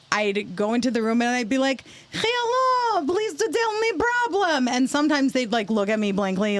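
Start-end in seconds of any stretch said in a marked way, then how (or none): 2.1-4.7 high, slow mock Russian accent; fake Russian accent. ER worker greeting an older Russian patient, fake Russian accent. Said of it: "I can do a Russian accent".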